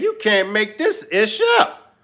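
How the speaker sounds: squeaky high disbelieving "come on"; exasperated high cry. Real, not acted. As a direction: throw up your hands in disbelief. Exasperated outburst at clip.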